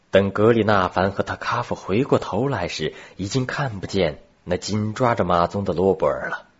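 ordinary delivery

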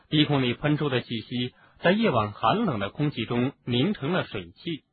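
ordinary delivery, nothing marked

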